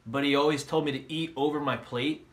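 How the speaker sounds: ordinary talk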